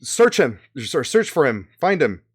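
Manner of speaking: loud, high commanding shout; shouted orders. Acted. Announcer knight orders the search, shouting. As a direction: bark orders at the guards. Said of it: "the announcer knight, go".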